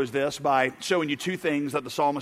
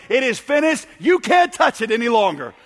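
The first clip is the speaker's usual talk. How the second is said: high, raised preacher's proclamation; loud voice; 5 semitones higher, 5 dB louder than usual. Retold or imitated. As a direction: call it out forcefully, warning them off. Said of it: "With a loud voice, he said".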